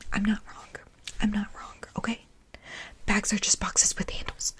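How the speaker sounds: ordinary talk, nothing marked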